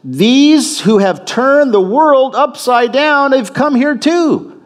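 high, drawn-out outraged public cry. Acted. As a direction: shout the accusation slowly, voice pitched right up. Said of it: "They're crying out this".

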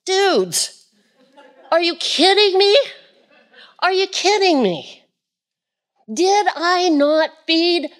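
high, slow incredulous teacher; casual, high disbelief; exasperated Jesus. Acted. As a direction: play Jesus fed up with the disciples. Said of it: "Jesus looks at him and goes".

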